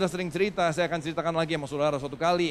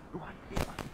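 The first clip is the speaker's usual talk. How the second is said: breathy secretive whisper; 11 dB quieter than usual. Retold or imitated. Whispering to his glaring wife as an excuse. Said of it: "Sambil bisik-bisik".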